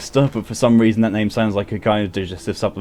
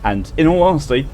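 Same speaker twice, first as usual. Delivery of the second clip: usual speaking voice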